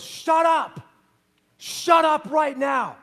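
high, loud furious yell; retold furious yell; angry shout. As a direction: snap and shout at her. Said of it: "he turns around and he looks at her".

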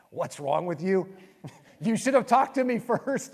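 playful retold telling-off, breaking into laughs; laughing mock scolding. Wife scolding her husband after his blunder, mock exasperation. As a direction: imitate an exasperated wife, rising high and loud through laughter.

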